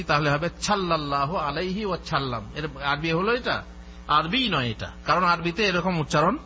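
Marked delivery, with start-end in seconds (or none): 0.0-2.5 সাল্লাল্লাহু said as chhallallahu, with an aspirated ch; ওয়াসাল্লাম read with ছ as written in Bengali; Bengali-spelling reading. Shown as a demonstration. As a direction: demo of the mispronounced সাল্লাল্লাহু. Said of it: "আমরা যদি সঠিক উচ্চারণ করি".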